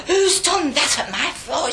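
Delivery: high, loud, drawn-out demand; outraged nun. Retold or imitated. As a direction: act out an outraged mother superior. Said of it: "that kind of attitude".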